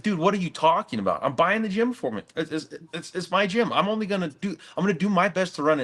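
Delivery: retelling his own exasperated protest, pitched high; half-acted frustration, voice up high; frustrated. To his business partner on the phone, frustrated pleading. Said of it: "And I'm getting kind of frustrated".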